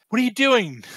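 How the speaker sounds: high-pitched mock alarm; alarmed rescuer. Rescuer spots jumper in joke.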